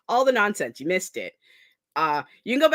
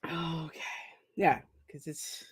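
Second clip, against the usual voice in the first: choked-up whisper; 3 semitones lower, 11 dB quieter, slower than usual. Real, not acted. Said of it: "get this emotional".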